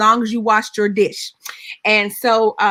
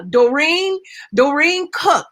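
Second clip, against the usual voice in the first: doctor calling out; 6 semitones higher, slower than usual. Retold or imitated. Doctor calls out a name.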